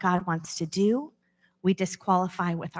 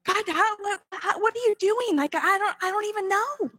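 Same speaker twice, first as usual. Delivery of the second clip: high-pitched frantic self-questioning; flustered panicking believer; 11 semitones higher than usual. Acted. Preacher voicing her own worried prayer, flustered and high. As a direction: spill it out in a rush of panicked questions. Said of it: "Sometimes I think".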